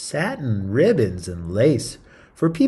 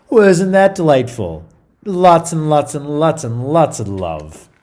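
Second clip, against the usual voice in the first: bright, louder delighted aside; delighted gush; 5 semitones higher, 7 dB louder than usual. Acted. Reader gushing to listeners about valentines, delighted. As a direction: gush over it with glee.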